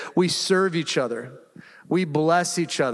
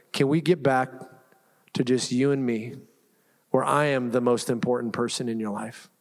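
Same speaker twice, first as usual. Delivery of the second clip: voicing God; 5 semitones lower than usual. Pastor voicing God's gentle call.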